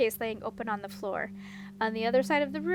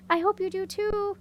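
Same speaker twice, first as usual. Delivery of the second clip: calling back; 7 semitones higher than usual. A narrator's reading. Eleonora to Simran leaving through the gate, calling back. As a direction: call it back over your shoulder. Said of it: "Eleonora called back".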